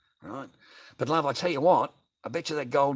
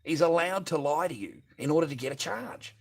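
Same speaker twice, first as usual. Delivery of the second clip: ordinary talk, nothing marked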